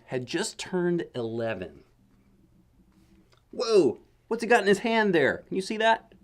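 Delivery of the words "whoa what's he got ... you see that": high, loud wonder at the picture; whoa, look at that; excited. Real, not acted. Reader to kids, amazed at the picture.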